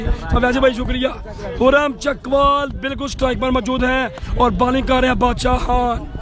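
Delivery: ordinary talk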